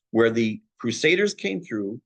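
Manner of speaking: ordinary talk, nothing marked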